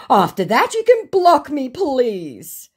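loud, high mock plea; acted-out begging, hammed up; pleading scammer.

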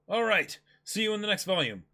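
ordinary talk, nothing marked

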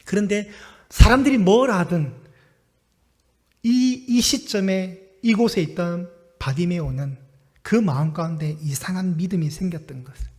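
ordinary delivery

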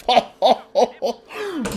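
high laughter; loud laugh. Real, not acted. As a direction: keep laughing hard. Laughing at the play.

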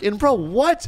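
ordinary talk

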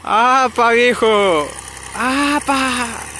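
loud high call across a field. Real, not acted. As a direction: shout to get his attention from far away. Calling to the old man passing.

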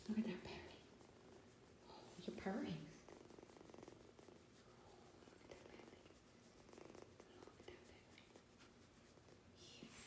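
hushed whisper over a purring kitten. Real, not acted. Owner whispering to the purring kitten, hushed.

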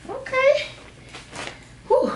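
very high strained okay; effort squeak. Real, not acted. Vlogger finally getting the tights up, strained high squeak.

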